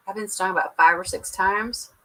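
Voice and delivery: normal talking voice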